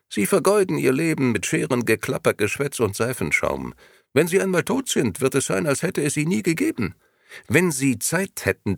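the grey gentleman working on the barber, lightly voiced; grey agent's pitch. A narrator's reading. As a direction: talk him into it like a smooth agent.

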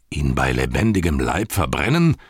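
drawn-up incredulous question; appalled question. Acted. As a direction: ask in grave disbelief. Gandalf reacts to horrifying news.

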